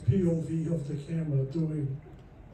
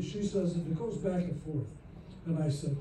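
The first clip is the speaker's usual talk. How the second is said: usual speaking voice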